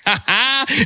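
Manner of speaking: high burst of laughter; laughing. Real, not acted. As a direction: laugh mid-quote. Laughs inside quote.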